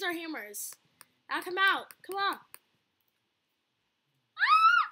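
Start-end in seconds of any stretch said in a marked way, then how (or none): 4.4-4.9 loud, very high shriek; startled scream. Real, not acted. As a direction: shriek as the monster appears. Startled during scary game.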